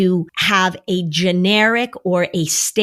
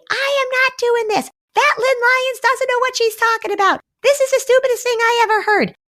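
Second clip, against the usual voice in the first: put-on much higher, whiny defiant kid voice; imitating defiant child. Child refusing the therapist's plan, in a high whiny voice. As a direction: protest like a stubborn kid. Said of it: "your child is gonna say".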